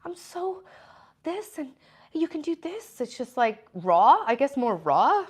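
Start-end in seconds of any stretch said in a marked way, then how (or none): 0.0-3.0 higher, breathy-light parody of a gushing self-help type; mock inspirational guru. Mocking fake-positive self-help authors, high airy parody voice. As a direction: mimic the sugary positivity people she doesn't want to sound like. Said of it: "one of these people who's like".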